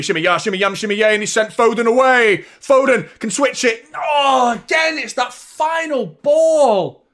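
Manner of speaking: rising to a shouted call as the attack builds; excited commentary. Real, not acted. Fan watching along, commentating Foden's run, building to a shout.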